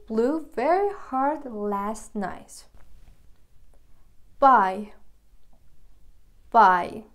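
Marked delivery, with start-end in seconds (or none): 4.4-4.9 "Buy" said loud and flat; loud headword. Shown as a demonstration. Teacher calls out the word "Buy", loud. 6.5-7.0 "Buy" said loud and flat; loud headword. Shown as a demonstration. Teacher calls out the word "Buy", loud. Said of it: "به معنی کنار یا توسطه".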